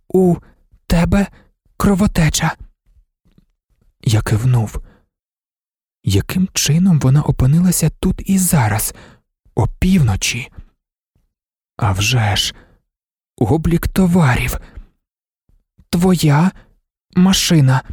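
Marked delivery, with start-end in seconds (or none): 0.1-2.6 high monotone, word ... by ... word; unmodulated, choppy speech. A narrator's reading. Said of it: "Вона вимовляла немодульовано й уривчасто". 15.9-17.8 high monotone, word ... by ... word; unmodulated, choppy speech. A narrator's reading. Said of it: "Вона вимовляла немодульовано й уривчасто".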